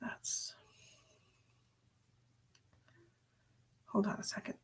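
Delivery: real whisper, breaking off mid-thought; whisper to herself. Real, not acted. Distracted psychic whispering to herself. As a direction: drop to a whisper while checking something. Muttering to herself while searching.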